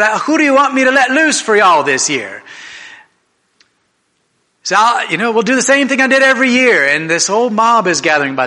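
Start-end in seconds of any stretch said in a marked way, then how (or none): 0.0-2.4 raised voice, louder and higher; Pilate addressing crowd. Retold or imitated. Governor offers the crowd a prisoner, calling out. 4.8-6.9 raised voice, louder and higher; Pilate addressing crowd. Retold or imitated. Governor offers the crowd a prisoner, calling out.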